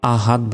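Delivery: light "a-haad" as the error; common wrong ahad. Shown as a demonstration. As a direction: say "আহাদ" Bengali-style, no bounce at the end. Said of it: "আমরা অনেকে কি বলি?".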